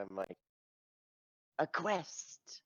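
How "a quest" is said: high-pitched, drawn-out heroic cry. Acted. Adventurer thrilled to hold a quest scroll, heroic. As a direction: announce it like a storybook hero. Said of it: "the stereotypical heroic".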